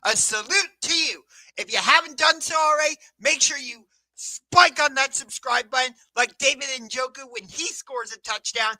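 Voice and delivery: loud, punchy talk-show host opener; hype show intro. Acted. Host greets YouTube viewers with a loud hype intro. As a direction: pump up the YouTube crowd.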